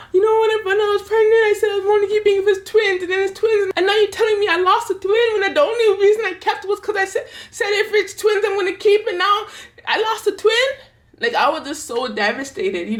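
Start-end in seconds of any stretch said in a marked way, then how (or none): 0.1-10.7 high, fast, sobbing rant through tears; retold bawling. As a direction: blurt it out high and fast, crying and half-laughing in disbelief. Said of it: "I was bawling".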